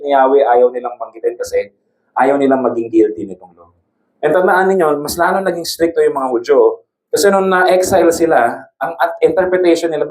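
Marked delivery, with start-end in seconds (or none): none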